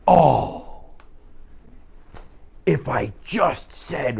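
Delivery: drawn-out, kicking-yourself regret; slow, agonized hindsight after an argument; regretful outburst. Acted. Driver replaying a finished argument, slow exaggerated regret. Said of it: "finished an argument, been driving away and went".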